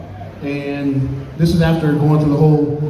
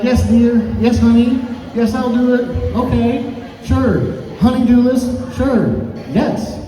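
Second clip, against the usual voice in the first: higher-pitched obedient husband; yes man; 7 semitones higher, slower than usual. Acted. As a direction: agree to everything in a singsong, higher voice. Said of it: "I was a yes man".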